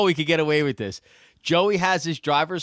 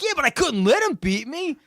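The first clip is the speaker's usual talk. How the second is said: retold cocky reply; 12 semitones higher than usual. Joey voiced in retold story.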